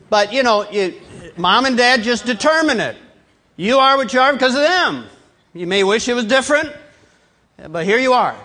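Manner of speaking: high, loud, emphatic pulpit delivery; full-force preaching. Real, not acted. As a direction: drive the point home to the whole congregation.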